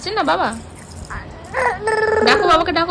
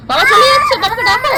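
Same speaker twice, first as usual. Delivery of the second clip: real parent's excited prodding; urgent coaxing; 6 semitones higher, 6 dB louder than usual. Real, not acted. Urging baby to call father.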